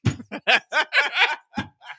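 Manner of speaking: high, helpless wheezing laugh; hard laughter. Real, not acted. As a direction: crack up laughing.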